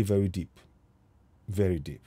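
usual speaking voice

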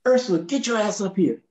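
high raspy Miles calling out; [name] voice. Retold or imitated. Miles calling Ursula up the stairs, raspy call. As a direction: summon her like Miles, imperious.

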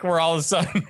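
normal talking voice

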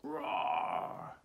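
high dinosaur roar. A narrator's reading. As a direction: roar like a rampaging T-Rex. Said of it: "He roared".

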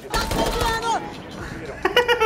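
high burst of laughter. Real, not acted. Laughs at kill. (risada aguda)

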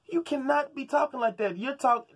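ordinary delivery

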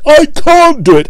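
high, strained straining puffs; puffing Gordon. A narrator's reading. Gordon straining up the hill, puffing high and strained. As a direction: gasp it out in rhythm. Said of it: "they puffed together".

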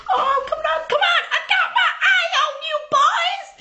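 high, fast, laughing mock-scolding; a mum teasing her kids, high and laughing. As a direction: playfully warn the boys while laughing. Woman teasing kids playing.

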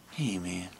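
low, soft, lazy camel drawl. Acted. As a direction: murmur it low like a laid-back camel. Camel puppet greets the host.

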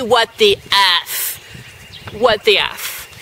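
low, slow disbelief; stunned at the quiz option. Real, not acted. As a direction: drag it out, stunned. Reacting to absurd quiz.

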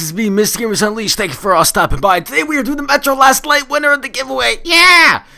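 high, loud hyped intro shout; hyped giveaway announcement. Real, not acted. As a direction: yell it out like a hyped YouTuber. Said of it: "Alright, that was weird".

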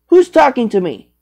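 ordinary talk

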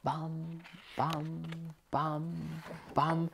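soft distant bam bam; far off drum. A narrator's reading. Music far off in the woods, soft chant. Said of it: "une musique au loin".

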